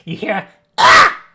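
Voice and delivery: sudden high piercing scream; sharp scream. Acted. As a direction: let out a shrill cry of pain. Distant enemy cries out.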